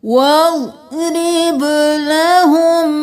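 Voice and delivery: slow melodic tajweed chant, drawn-out vowels; Quran recitation. Shown as a demonstration. Teacher chanting the verse for students, slow melodic recitation. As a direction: intone each word slowly like a reciter.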